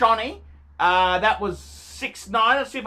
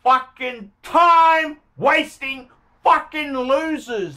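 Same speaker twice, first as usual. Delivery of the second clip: slow, punched-out angry insult; angry contempt; 3 semitones higher, 4 dB louder, slower than usual. Real, not acted. Seller on Gumtree's timewasting buyers, angry contempt. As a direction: spit it out word by word, drawn out.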